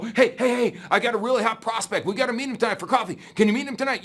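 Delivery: mock eager recruit rushing the news; excited phone call. Recruit calls sponsor about a hot prospect, excited fast voice.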